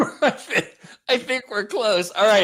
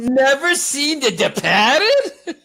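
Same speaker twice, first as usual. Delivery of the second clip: mock incredulous; 6 semitones higher, 4 dB louder, slower than usual. Boston Wahlberg incredulous.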